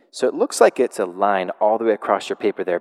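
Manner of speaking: ordinary talk, nothing marked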